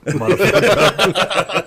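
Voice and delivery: dad on the phone, half-laughing in mock panic; panicked confession. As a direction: drag it out like a guilty old man in a panic. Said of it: "I get this panic phone call".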